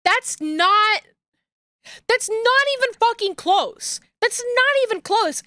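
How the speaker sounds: real indignation at the costume; that's not even close, outraged; exasperated outrage. Real, not acted.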